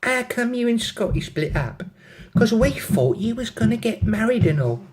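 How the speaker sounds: high put-on London accent; pry in high-pitched London. London girl asking about her friend's break-up, London accent. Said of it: "have a go at the accents".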